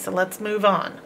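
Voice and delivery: normal talking voice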